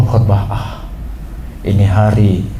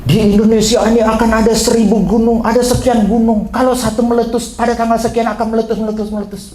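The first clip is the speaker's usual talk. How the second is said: mock prophet; 6 semitones higher than usual. Mocking a false prophet's prediction.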